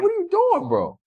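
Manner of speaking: alarmed protest. Retold or imitated. Guest replays confronting the friend, high alarm. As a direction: squeak it out in alarm.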